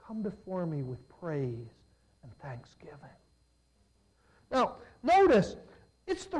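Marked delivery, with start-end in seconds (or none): 0.1-3.2 soft, dropping to a whisper; hushed voice of God. Retold or imitated. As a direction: let God's words fall to a whisper. God's voice, hushed.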